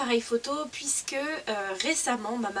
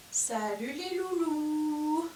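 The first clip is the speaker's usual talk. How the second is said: cooing pet voice; 4 semitones higher, much slower than usual. Real, not acted. Greeting the foals in the field.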